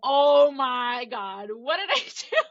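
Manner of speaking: drawn-out "oh my God", climbing to a high pitch; overwhelmed and anxious. Retold or imitated. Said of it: "overwhelm and anxiety just".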